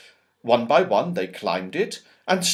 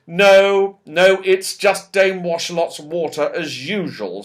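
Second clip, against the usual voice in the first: a touch of crossness, louder start; cross Joe; 4 semitones higher, 4 dB louder than usual. Acted. Joe explaining to Moonface, mildly cross. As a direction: grumble it out crossly. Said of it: "said Joe crossly".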